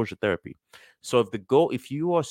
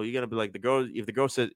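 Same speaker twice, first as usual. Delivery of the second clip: ordinary delivery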